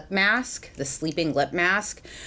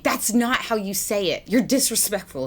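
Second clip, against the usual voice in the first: mock indignant scolding; 5 semitones higher than usual. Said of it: "that would be like me yelling".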